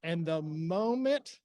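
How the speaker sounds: ordinary talk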